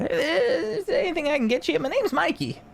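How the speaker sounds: high, eager little merchant voice; jolly tinkerer. Acted. Mikey introducing himself to the party, high and eager. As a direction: offer help like a beaming shopkeeper. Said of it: "he goes".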